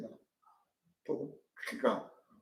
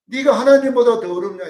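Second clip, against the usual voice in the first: louder, higher mock anger; heated retort; 6 semitones higher, 8 dB louder than usual. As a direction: throw the question at Job hotly. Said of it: "소발이 그냥 열받아갖고".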